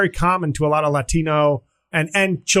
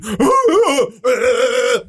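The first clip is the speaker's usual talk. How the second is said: mock gasp; 6 semitones higher, 3 dB louder than usual. Mock shocked reaction.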